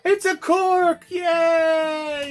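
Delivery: high, slow, ecstatic cry of joy; thrilled shout. Real, not acted. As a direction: cheer it like opening a gift. Thrilled the bottle has a cork.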